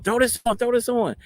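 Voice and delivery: high-pitched urgent mimicry; hurried staff order. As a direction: imitate staff rushing someone to get dressed.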